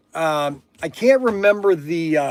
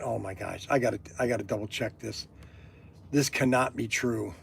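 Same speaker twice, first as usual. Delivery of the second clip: awed hush; 9 semitones lower, 13 dB quieter than usual. Acted. Disbelief, checking the reservation.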